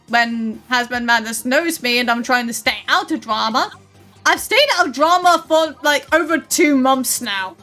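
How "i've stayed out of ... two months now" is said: ordinary talk, nothing marked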